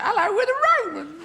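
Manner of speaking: absurdly high, squeaky Roman voice; imagined Roman accent. Acted. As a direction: pipe it loudly, far above your own pitch. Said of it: "for all they knew, could have said".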